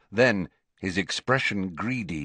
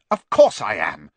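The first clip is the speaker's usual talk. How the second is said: much louder, abrupt; impatient outburst; 3 semitones lower, 9 dB louder than usual. A narrator's reading. Boy interrupting the professor, impatient outburst. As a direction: cut in forcefully.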